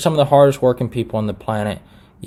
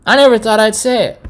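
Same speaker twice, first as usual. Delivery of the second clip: exasperated exclamation; 11 semitones higher, 6 dB louder than usual. Real, not acted. Reluctant confession, rising excitement.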